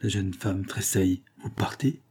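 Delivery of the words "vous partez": soft, higher-pitched question; softened voice. A narrator's reading. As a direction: ask gently, taken aback. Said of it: "demanda-t-elle d'une voix plus douce". (voix plus douce)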